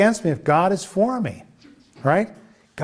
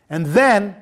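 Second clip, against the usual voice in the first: ordinary talk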